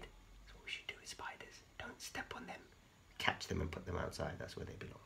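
soft unvoiced whisper. Real, not acted. Teacher whispers spider advice to the children.

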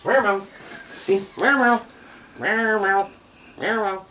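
high playful kitty meows; little kitty meow. Pretend. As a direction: meow like a tiny kitten. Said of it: "That's like a little kitty".

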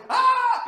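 high, loud laughing shriek; mock panic yelp. Panicked at own pulse.

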